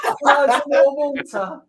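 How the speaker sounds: imagined little boy in the pool, high, loud put-on voice. Co-host teasing dad about his paddling pool, mock child voice. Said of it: "he's sitting there, he's like".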